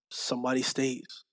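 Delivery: ordinary talk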